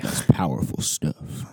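slow hushed whisper; mock awed whisper. Cohost whispering in mock awe at the answer. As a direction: breathe it out like it's profound.

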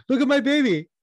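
very high, loud gushing squeal; high-pitched hammed-up boast; mock proud mother. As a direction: show off the baby in a squeaky mock voice. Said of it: "She was like".

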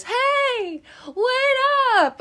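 high mock holler from down the street; calling out. Drunk frat boys calling after the group, high hollering.

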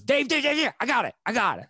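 a hint of the owner hurrying over; imitating club owner.

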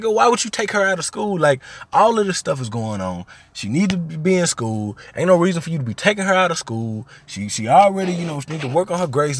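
high-pitched, exasperated retold argument; getting mad. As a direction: argue indignantly on her behalf. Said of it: "I'm getting mad".

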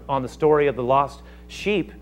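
ordinary talk, nothing marked

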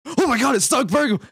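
high, loud mock alarm; startled shout. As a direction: shout it in retold fright. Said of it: "he was like".

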